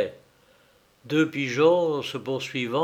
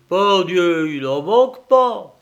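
loud, higher peasant declaration; farmer's emphatic exclamation; 5 semitones higher, 8 dB louder than usual. Acted. Farmer declares after deep thought. (exclamation sonore de paysan)

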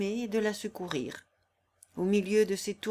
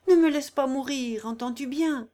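coarse singer crying out between pains, a bit higher; brash then pleading woman in labour; Corilla in labour; 5 semitones higher, 6 dB louder than usual. Acted. Corilla to the stranger helping her, raw and pained.

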